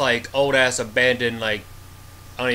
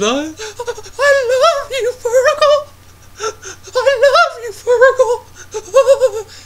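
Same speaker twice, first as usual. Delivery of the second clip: very high, loud, hammed-up weepy falsetto with laughter breaking through; mock the heroine's tearful goodbye, laughing as you wail it; mock sobbing heroine; 7 dB louder, slower than usual. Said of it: "she's, like, holding him in his arms".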